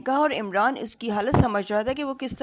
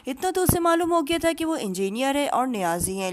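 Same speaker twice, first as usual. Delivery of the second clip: usual speaking voice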